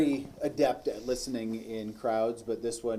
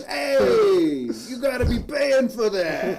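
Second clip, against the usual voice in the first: put-on shouted Boston accent, much higher; 11 semitones higher, 7 dB louder, slower than usual. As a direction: yell it like a Boston tough guy. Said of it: "started going".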